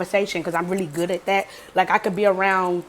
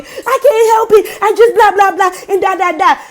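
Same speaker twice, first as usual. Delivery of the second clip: high, loud pleading excuses; hammed-up hysterical plea; mock wailing; 10 semitones higher, 6 dB louder than usual. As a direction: imitate someone tearfully talking over you. Girl wailing excuses over her.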